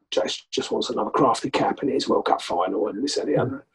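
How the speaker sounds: quiet, whispered grumble of an imagined coach; muttered imagined thought. Retold or imitated. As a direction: mutter it under your breath, dismissive.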